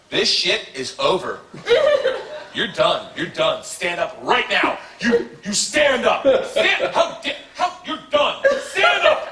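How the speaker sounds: slow, building to a high yell; hammed-up furious chaperone; mock angry teacher. Chaperone ordering the students up, mock yell rising high.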